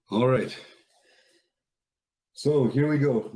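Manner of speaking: ordinary talk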